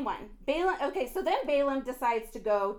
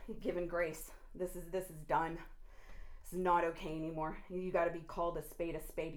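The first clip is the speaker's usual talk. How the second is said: lower, softer, grim judgement; 10 semitones lower, 6 dB quieter than usual. Real, not acted. Preacher to the deceivers, low grim verdict. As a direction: say it low and final.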